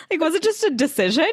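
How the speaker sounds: high and fast through a laugh; laughing while asking. Real, not acted. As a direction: ask it while still laughing. Laughs, then asks excitedly.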